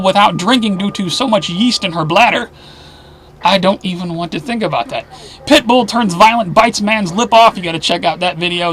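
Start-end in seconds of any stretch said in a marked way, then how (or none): none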